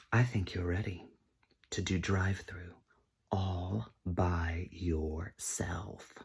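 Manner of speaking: hushed, drawn-out dramatic pronouncement; slow to a near-whisper at the end; soft mock-solemn manager voice.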